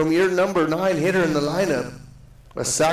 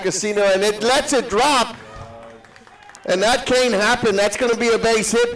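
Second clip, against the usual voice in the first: excited; 6 semitones higher than usual. Real, not acted. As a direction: caught off guard by a dropped ball. Second baseman lets ball drop.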